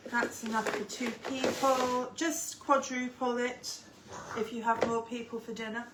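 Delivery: usual speaking voice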